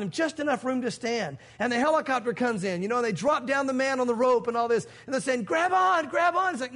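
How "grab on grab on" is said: high urgent call. Retold or imitated. Helicopter crew urging the man to grab the rope, high call. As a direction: yell it up to the man on the roof.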